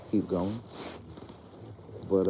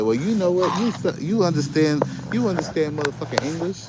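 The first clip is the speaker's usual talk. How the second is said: mock telling off; 7 semitones higher, 4 dB louder than usual. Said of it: "so I cussed Tony out".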